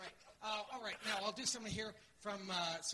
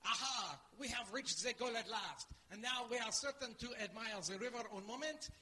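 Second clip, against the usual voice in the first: slightly raised, grand French accent; theatrical French-accented announcement; 3 semitones higher than usual. Shown as a demonstration. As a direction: declaim like a triumphant Frenchman. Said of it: "French, that's right".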